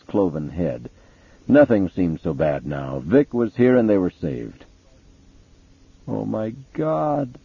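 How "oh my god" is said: slow, thin and shaken; thin rising voice. Acted. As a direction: let the voice thin out as it rises. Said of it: "his voice rising thinly in the stillness".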